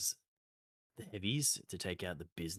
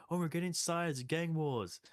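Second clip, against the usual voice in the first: excited dim dad, pitched way up; Homer; 10 semitones higher, 3 dB louder than usual. Acted. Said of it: "And where Homer's like".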